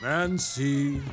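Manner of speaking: high, drawn-out ghostly calling; playful haunting croon; mock spooky call. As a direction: tease with a rising spooky wail. Teasing spooky vampire banter.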